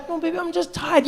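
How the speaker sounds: put-on soft, high sleepy girlfriend voice; tired girlfriend. Girlfriend pleading she is tired, soft and high. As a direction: murmur it like she's half asleep. Acted girlfriend answering softly.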